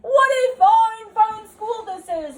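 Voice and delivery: an ecstatic principal announcing to the whole school; booming principal. Acted. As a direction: proclaim it like an overjoyed headmaster. Principal character voice.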